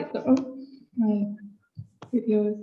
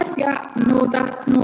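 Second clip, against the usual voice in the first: muffled, bubbly underwater sound; 4 semitones higher than usual. Real, not acted. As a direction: voice through a bad underwater line. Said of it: "it sounds like you're underwater".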